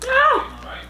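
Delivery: short, very high "ah!"; startled cry. Real, not acted. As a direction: yelp as the juice drips. Exclaims at dripping juice.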